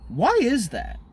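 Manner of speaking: high, put-on puzzled voice; mock innocent question. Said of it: "And I'm like".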